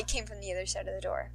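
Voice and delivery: ordinary delivery, nothing marked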